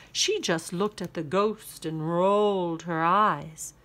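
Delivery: ordinary delivery, nothing marked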